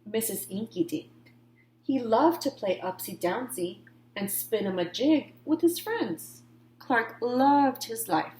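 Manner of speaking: ordinary delivery, nothing marked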